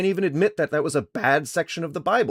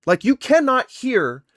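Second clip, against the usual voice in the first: higher, louder and slow, in disbelief; exasperated; 9 semitones higher, 6 dB louder, slower than usual. Real, not acted. Streamer arguing with a caller, exasperated. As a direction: hammer each word in exasperation.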